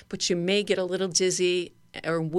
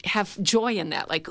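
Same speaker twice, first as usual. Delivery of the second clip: normal talking voice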